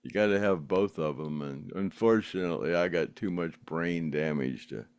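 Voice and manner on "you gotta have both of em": ordinary talk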